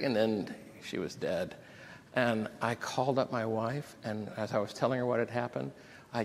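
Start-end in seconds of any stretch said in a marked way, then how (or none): none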